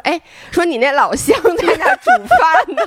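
can't keep a straight face; laughing through a quote. Real, not acted. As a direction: quote the joke while cracking up. Teasing quote while laughing.

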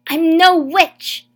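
high, very loud cry of denial; desperate shout. A narrator's reading. Mother pinned by guards, desperate loud shout. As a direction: scream it as guards drag you down. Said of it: "my mother shouted".

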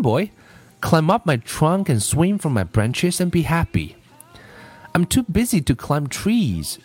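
measured storybook reading with lilting pitch; story read-aloud. A narrator's reading. Teacher reading a story for listening practice, storytelling. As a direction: read it like a gentle picture-book tale.

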